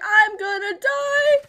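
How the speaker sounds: high, slow, hammed-up dread; mock doom. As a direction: drag out the doom like a terrified passenger. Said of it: "I was sitting there the whole time like".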